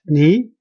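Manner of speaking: "你" rising steadily; second tone. Shown as a demonstration. Teacher showing the changed first one, "你" rising. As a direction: lift the pitch through "你". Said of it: "changed to a second tone".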